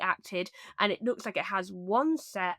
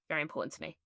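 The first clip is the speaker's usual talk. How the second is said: softer, murmured repeat; quiet aside; 4 semitones lower, 9 dB quieter, much faster than usual. Real, not acted. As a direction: drop it under the breath. Quiet echo of own phrase.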